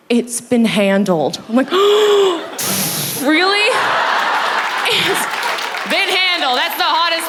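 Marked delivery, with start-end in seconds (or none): 0.1-1.4 slow, deliberate action-hero delivery; dramatic husband. Retold or imitated. Husband returns sweaty from the fight, dramatic and slow. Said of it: "points at me and says". 1.7-3.2 long, high mock gasp; aroused gasp. Wife reacts to her husband's bravado, exaggerated gasp. 3.2-7.3 high, hammed-up aroused echo; swooning thrill. Wife echoes her husband's tough line, hammed-up swoon.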